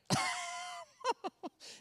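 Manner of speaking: shrill giggle; high burst of laughter. Retold or imitated. Son laughs at mom's news of the ticket, high laugh. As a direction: cackle high and sudden.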